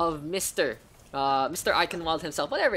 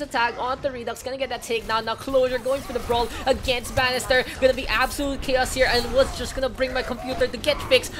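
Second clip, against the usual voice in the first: louder, higher brawl call; hyped casting; 8 semitones higher, 6 dB louder than usual. Acted. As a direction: build the chaos of the fight. Brawl play-by-play.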